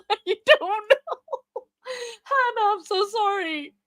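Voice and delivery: slow, high laugh-crying apology. Real, not acted. Streamer apologising to Hannah, laugh-crying. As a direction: laugh through tears of guilt.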